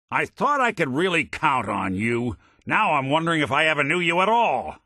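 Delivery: hurt, rising accusation; angry confrontation. Acted. Detective confronting a partner he counted on, angry.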